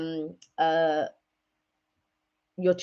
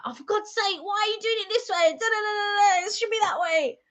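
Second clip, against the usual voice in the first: high-pitched mock exasperation; imitated nagging in a high voice; exasperated bickering; 14 semitones higher than usual. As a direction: act out a silly squabble, voice way up. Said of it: "we argue over the silliest things".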